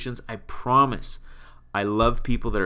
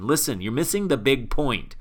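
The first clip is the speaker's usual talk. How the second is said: exasperated explaining; 6 semitones higher, 5 dB louder than usual. Retold or imitated. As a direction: voice jumps high and loud on free money, laughing through it.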